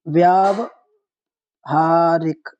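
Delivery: very slow व्यावहारिक, split after a long "vyaav"; syllable-by-syllable demonstration. Shown as a demonstration. Teacher showing how it is written, very slow and split up. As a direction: stretch व्यावहारिक out, pausing before "haarik". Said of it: "किस तरीके से?".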